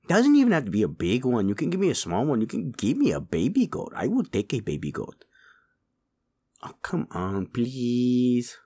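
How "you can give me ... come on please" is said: normal talking voice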